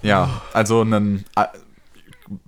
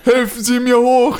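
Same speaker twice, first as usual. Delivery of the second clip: high, loud drawn-out yell; imitated street bellowing; 12 semitones higher, 5 dB louder, slower than usual. Narrator re-enacts homeless man yelling at strangers, imitated bellow. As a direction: wail it across the open street. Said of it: "dieses Anbrüllen auf offener Straße, so vier, fünf Mal".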